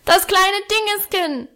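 put-on very high little-boy yell; high-pitched childish bawl; bellowing child. A narrator's reading. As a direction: yell it like a demanding toddler. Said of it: "brüllte er".

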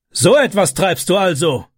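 a hint of a furious stepfather shouting. A narrator's reading. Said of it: "schrie er". (gespielt wütender Ausruf des Stiefvaters)

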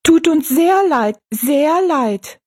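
very high, drawn-out little voices; squeaky dwarf chorus; echoing dwarfs. A narrator's reading. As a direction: echo it as tiny folk. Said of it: "echoten die Zwerge".

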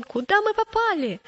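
high-pitched, startled question; alarmed cry. A narrator's reading. Boy sinking in the bog to his companion, crying out in alarm. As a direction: exclaim in sudden dismay. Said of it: "закричал он".

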